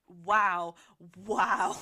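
loud, emphatic disbelief; appalled reaction. Retold or imitated. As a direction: belt out each word in shock. Recounting shock at attempted drowning.

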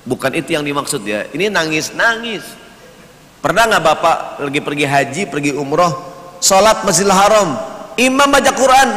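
ordinary delivery

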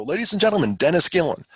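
imitated emcee introduction, high and loud; event announcer. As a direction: announce a speaker to a crowd.

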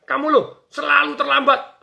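high, loud scolding, slowing on selalu terlambat; angry scolding. Retold or imitated. Boss scolding a late employee, loud angry scolding. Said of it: "begitu dimarahin".

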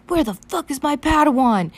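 loud, high, fiery outburst; hot-headed demand. Acted. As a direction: demand it like a furious hothead. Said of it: "his hot-headed ass".

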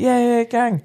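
high-pitched, eager mock agreement. Acts out his eager acceptance of headphones.

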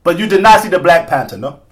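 accusing question in mock African accent. Host challenging co-host about the movie, mock African accent. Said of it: "say that with my African accent".

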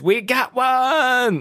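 high, drawn-out triumphant call; Ghostbusters catchphrase holler. Acted. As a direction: sing out the catchphrase, stretched long and high. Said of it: "hits a bell and says".

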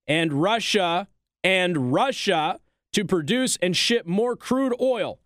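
slow, pointed repeat of "and Russia"; pointed repetition. Acted. Commentator mocking the Biden administration's plea, slow and pointed. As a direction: lean hard on each "and Russia", slowly.

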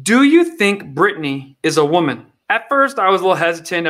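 ordinary talk, nothing marked

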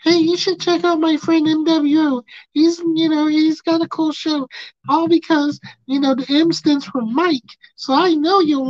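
high, loud sing-song mock pitch; high cheery voice selling a friend's show. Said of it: "and be like".

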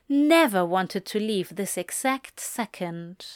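plain reading voice

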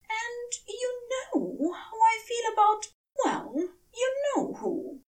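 the tired angel complaining in a high voice; Aziraphale voice. Acted. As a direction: lift way up into the weary angel, ending in a whisper. Narrator voices Aziraphale again.

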